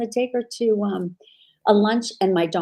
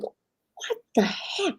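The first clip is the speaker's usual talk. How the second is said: high, slow, breathy exasperated whisper; a hushed, stretched-out "what the heck" of annoyance; irritated retort; 10 semitones higher, 6 dB quieter, much slower than usual. Retold or imitated. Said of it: "Like, I was angry".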